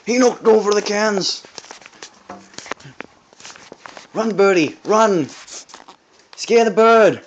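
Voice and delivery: loud urgent shouting. Real, not acted. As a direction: yell at them to chase it. Yelling orders to chase bird.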